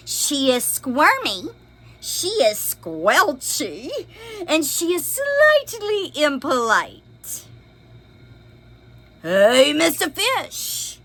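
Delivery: lively storybook read-aloud; expressive picture book reading; reading to children. A narrator's reading. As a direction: read it big for little listeners.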